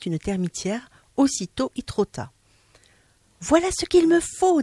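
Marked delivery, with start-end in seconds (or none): 3.4-4.6 high, pleased voice; lightly played woman; high female character voice. Madame Vazedoux claiming the termite mound, high pleased voice.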